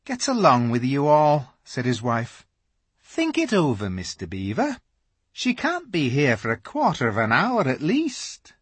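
high beaver-wife voice; reassuring Missus Beaver; calm Missus Beaver. A narrator's reading. Missus Beaver calms husband.

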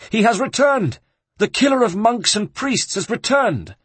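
high, loud cry of alarm; thunderous proclamation. A narrator's reading. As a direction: announce it to the whole flock in alarm. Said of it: "pronounced in a voice of thunder".

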